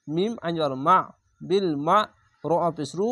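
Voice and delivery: ordinary talk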